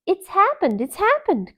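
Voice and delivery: high, loud, breathless with news; excited call. Acted. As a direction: burst out with the great news. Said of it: "called Mother Rabbit".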